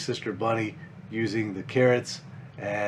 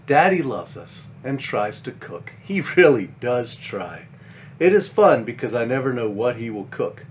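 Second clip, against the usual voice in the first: put-on gentle, lively storybook read for kids; reading to children; 6 dB louder than usual. A narrator's reading. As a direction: read it like a bedtime picture book. Reads children's book aloud.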